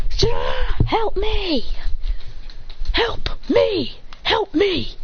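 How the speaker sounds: high-pitched cry for help; acted panicked plea. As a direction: plead in a high play-panic voice. Acted plea while fleeing a pursuer.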